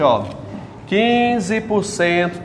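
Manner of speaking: normal talking voice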